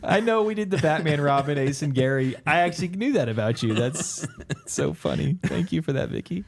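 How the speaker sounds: talking through high giggles; laughing. Real, not acted. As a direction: laugh while trying to speak. Host laughs at mom's answer.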